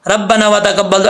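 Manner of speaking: रब्बाना व तकब्बल rushed, dropping the alif after नून; rushed wrong reading. Shown as a demonstration. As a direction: hurry through रब्बाना व तकब्बल carelessly. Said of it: "ऐसे नहीं पढ़ना जल्दी में".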